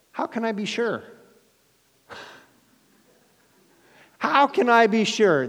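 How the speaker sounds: put-on high, doubtful old man's voice; doubting Zechariah. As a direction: ask it as a doubting old priest.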